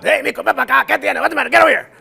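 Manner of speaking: high, booming calling-out voice; a father calling his son over, loudly; loud-talking dad. Retold or imitated. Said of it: "was a loud, loud".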